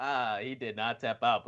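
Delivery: ordinary delivery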